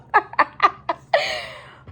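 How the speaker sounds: real cackling laughter, loud and high. Real, not acted. Vlogger still tickled by underdog, loud high laugh. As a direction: laugh hard, letting it trail down.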